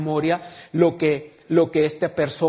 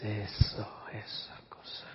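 hushed; 5 semitones lower, 12 dB quieter, slower than usual. Real, not acted.

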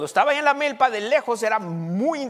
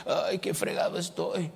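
mock whining; 4 semitones lower, 7 dB quieter than usual. Mocking self-pitying talk.

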